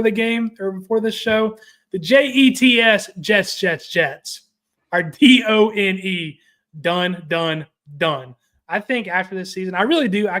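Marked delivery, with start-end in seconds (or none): 1.9-8.3 louder, letter by letter, then repeated; spelled-out emphasis. Real, not acted. Mocking the Jets to his cohost, spelled out and hammered. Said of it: "spells out Jets are done".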